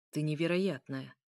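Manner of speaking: ordinary delivery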